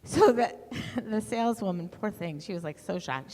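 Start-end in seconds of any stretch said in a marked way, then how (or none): none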